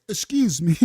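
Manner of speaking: low, overly polite excuse me; a hint of a prissy polite voice; mock polite. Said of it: "She did not say".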